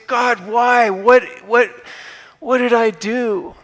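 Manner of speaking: mock wail of "why me"; complaining to God. A believer protesting to God in a pleading whine.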